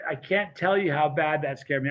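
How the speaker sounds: usual speaking voice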